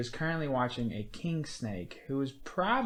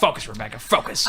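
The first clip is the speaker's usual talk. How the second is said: firm, loud call to attention; mock stern order; 2 semitones lower, 6 dB louder than usual. Real, not acted. Host reining in distracted co-host, mock stern. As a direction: snap a distracted friend back on task.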